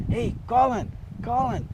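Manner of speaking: high mock call over a back fence; imitating a late-night shout. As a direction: call Colin's name up to the house. Calling out at night.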